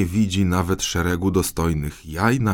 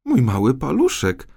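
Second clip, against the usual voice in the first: a touch of teasing, lightly higher; playful reply; 6 semitones higher than usual. Acted. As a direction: tease the king with a coy answer. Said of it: "odparła figlarnie Angelika". (figlarnie, przekornie)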